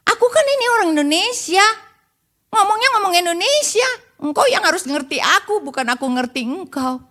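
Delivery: high-pitched protest, easing down at the end; mock indignant insistence. Indonesian abroad refusing to learn the language, mock indignant. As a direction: play someone stubbornly demanding to be understood. Acted stubborn Indonesian in America.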